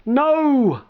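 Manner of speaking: high, loud despairing cry; distraught exclamation. Acted. Narrator cries out as the stranger runs away. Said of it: "I exclaimed, distraught".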